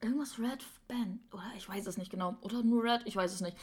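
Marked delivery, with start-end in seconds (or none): 0.0-2.4 whispering. Real, not acted. Unsure about the candy name.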